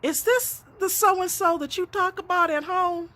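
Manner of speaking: put-on high, childish voice of a curious daughter, rising to a laughing squeal; piping child. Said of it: "my little precious pipes up from the back seat".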